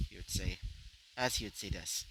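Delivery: plain reading voice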